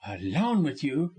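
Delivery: appalled echo with rising question; repelled, questioning tone; disgusted. A narrator's reading. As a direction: throw the words back in disgust. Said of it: "repeated the widow in tones of disgust".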